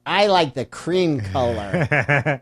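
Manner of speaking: put-on slow, dreamy girl voice with a laugh; imitating a girly buyer. Mocking the next buyer who just loves the paint, slow girly voice. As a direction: drawl it like a smitten young buyer.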